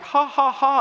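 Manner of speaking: loud, high mock ha-ha; hammed-up laugh in separate bursts; imitated hearty laughter. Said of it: "on the outside, you're like".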